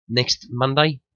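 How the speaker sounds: ordinary talk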